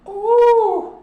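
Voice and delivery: sudden high delighted ooh; a squeal of wonder at the mushroom; surprised ooh. Real, not acted. Seeing the lion's mane mushroom.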